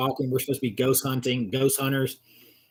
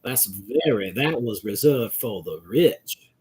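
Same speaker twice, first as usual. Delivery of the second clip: slow, stretched genteel Georgia accent; light rich-Southerner lilt; aristocratic Southern lilt; 2 semitones lower, slower than usual. Shown as a demonstration. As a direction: drawl it like old Southern money. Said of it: "When they talk like this".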